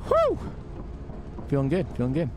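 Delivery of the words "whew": high relieved whew. Real, not acted. Relief after escape.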